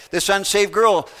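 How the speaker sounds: normal talking voice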